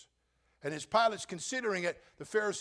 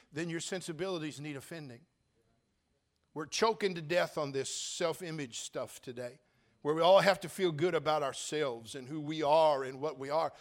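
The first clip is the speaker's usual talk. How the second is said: ordinary talk, nothing marked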